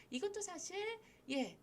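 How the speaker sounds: usual speaking voice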